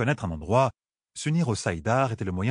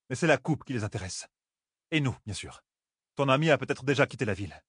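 raised, steady and firm; calm Aiel woman; 5 semitones higher, faster than usual. Acted. Avienda reasoning with Nineveh, firm raised voice. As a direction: lay out the reasons plainly.

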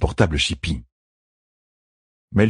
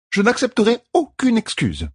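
higher, brisk refusal; cutting in. A narrator's reading. Midwife cuts off the queen, firmly. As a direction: shut the protest down. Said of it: "coupa Melfein".

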